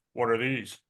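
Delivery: low, put-on doctor voice; deep doctor's voice. Doctor questioning the patches before surgery, imitated in a deep voice. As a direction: drop down deep to mimic the surgeon. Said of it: "in his deep voice, not his normal voice".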